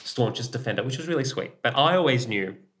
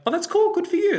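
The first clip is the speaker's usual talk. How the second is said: much higher, louder, upbeat and cheery; bright supportive; 4 dB louder, faster than usual. Shown as a demonstration. Friend hearing a coming out, higher and cheerier. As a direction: cheer them on, high and bright. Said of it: "that's the, not the".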